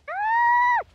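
very high, loud shriek; sudden piercing gamer scream; frustrated scream. Real, not acted.

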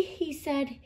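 usual speaking voice

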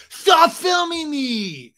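high, drawn-out mock shriek; hammed-up outraged yell; tantrum scream. Acted Tom's tantrum.

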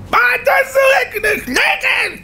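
shrill, slow, very high scream of a broken woman. Retold or imitated. The rabbi imitates the madwoman screaming at someone throwing bread. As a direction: scream it like the traumatized woman in the street. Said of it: "הייתה צורחת ברחובות".

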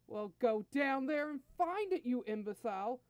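irate prince berating his minion; angry order. Acted. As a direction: order the fool out, exasperated. Furious prince orders Nappa down.

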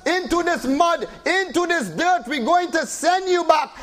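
usual speaking voice

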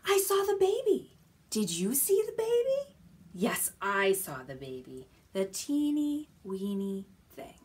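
singsong storybook voice for little ones; reading to a toddler. Acted. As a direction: read it playfully, question and answer. Reading picture book to toddlers.

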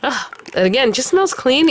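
ordinary talk

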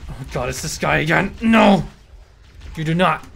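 loud, rising shout of protest; frustrated yell. Real, not acted. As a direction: yell it at the screen. Annoyed gamer yelling at enemy.

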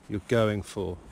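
normal talking voice

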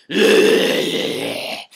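loud wordless rasping growl; mock Batman growl. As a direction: growl loudly with no words. Mimics Bale's Batman growl wordlessly.

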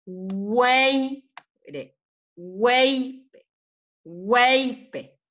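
"Weipe" with WEI held high, PE dropped; stress on first syllable. Shown as a demonstration. Teacher shows the strong part, "weipe" stressed on WEI. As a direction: stretch and lift WEI in each "weipe". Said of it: "es aquí el fuerte".

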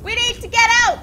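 urgent, high panicked shout. Real, not acted. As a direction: yell to escape, alarmed. Panicking in a dangerous cave.

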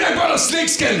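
high, loud, pushing it at the crowd; exasperated loud repeat. Shown as a demonstration. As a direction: say it again like they weren't listening. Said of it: "Are you listening?".

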